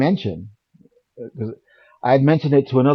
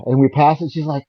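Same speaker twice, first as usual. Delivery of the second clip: ordinary talk, nothing marked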